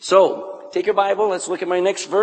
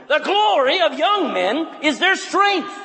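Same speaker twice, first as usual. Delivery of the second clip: loud and strong; 7 semitones higher than usual. Shown as a demonstration. Preacher showing a boy how to read scripture, loud and powerful. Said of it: "Don't hold back, man".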